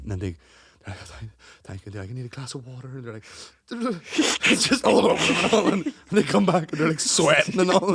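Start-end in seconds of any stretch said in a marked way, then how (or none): 1.6-2.9 shaken hush. Retold or imitated. Mock shaken contestant.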